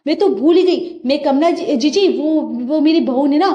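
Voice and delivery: ordinary delivery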